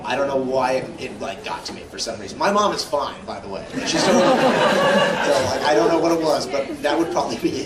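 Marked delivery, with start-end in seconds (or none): 3.9-5.3 louder, slow, laughing insistence; laughing reassurance. Real, not acted. Telling fans his mom is fine, laughing.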